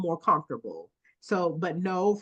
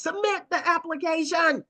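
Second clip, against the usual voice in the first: high-pitched urging; 8 semitones higher than usual. Real, not acted. Urging applicants.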